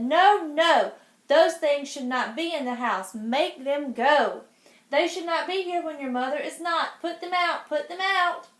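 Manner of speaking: put-on worried fish warns the children, loud and fussy; scolding fish. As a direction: make that cat leave now. Fish alarmed.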